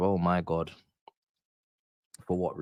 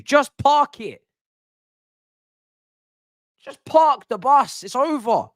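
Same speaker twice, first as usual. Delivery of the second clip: high-pitched exasperated questions; frustrated rant; 9 semitones higher than usual. Real, not acted. Gamer scolding his own side, high and exasperated. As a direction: berate the players, voice pitched up.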